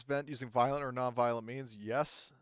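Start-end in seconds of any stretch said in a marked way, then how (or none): none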